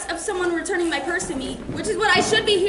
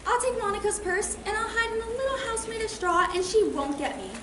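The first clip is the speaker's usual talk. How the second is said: higher-pitched little pig in a bedtime story; scheming piggy's private thought, a bit softer; 4 semitones higher, 5 dB quieter than usual. Acted. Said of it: "And the piggy thought".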